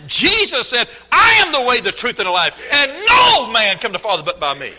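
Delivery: loud, high, driving sermon delivery; full-force preaching. Real, not acted. Preacher urging the congregation to call on Christ, full-force preaching. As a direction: proclaim scripture, punching key words to a shout.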